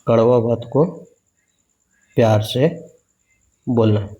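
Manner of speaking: word by word with long pauses, for writing down; dictating to students so they can write it; slow dictation. Shown as a demonstration. Spacing out each chunk for the class to copy. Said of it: "ऐसे लिख सकते".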